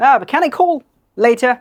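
'call' with a puff of air after k; example with aspirated k. Shown as a demonstration. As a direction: model the kh sound in 'call'. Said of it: "Like this". ('call' মহাপ্রাণ খ দিয়ে)

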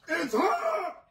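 high, drawn-out wail of complaint; pained yell. Real, not acted. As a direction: cry out from a burning mouth. Grandson burning from hot gummy bear.

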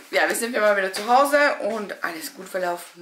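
ordinary talk, nothing marked

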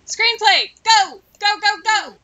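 loud, high cheering on; excited urging. Real, not acted. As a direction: shout her on like a coach. Urging her sim to write.

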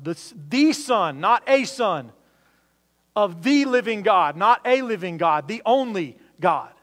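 preacher pounding on "the Son" versus "a son"; emphatic contrast. Real, not acted. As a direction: drive home "the" against "a".

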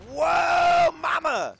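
high loud exclamation; excited whoa. Real, not acted. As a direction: shout it amazed.

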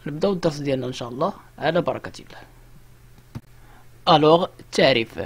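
normal talking voice

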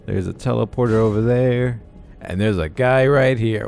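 ordinary delivery

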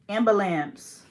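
"Ambulance" as AM-buh-lamps; loud first beat, "Ambulance" ending in -amps; disliked pronunciation. Retold or imitated. Showing how people say it wrong, disliked pronunciation. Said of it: "I hate when people say that".

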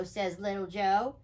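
ordinary delivery

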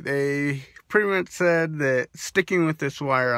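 lip held open by finger; talking with finger on lip; finger pulling lip down. Real, not acted. Seen 2: Finger hooks lower lip down, showing braces, throughout the labelled words.

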